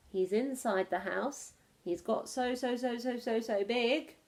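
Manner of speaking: ordinary delivery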